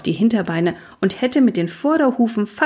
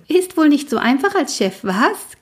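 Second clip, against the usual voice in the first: grinning tease; 8 semitones higher than usual. Acted. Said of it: "grinste Keks".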